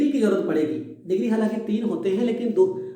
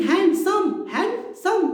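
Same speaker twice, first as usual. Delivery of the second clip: Handsome broken into two beats, hand and सम; split into syllables; 9 semitones higher, much slower than usual. Shown as a demonstration. Teacher showing students the word takes two goes, split into syllables. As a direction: pronounce handsome in two separate parts. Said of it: "दो बार हो गया".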